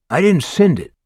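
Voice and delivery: high, loud denial. Acted. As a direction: snap back a loud denial. Denial under questioning.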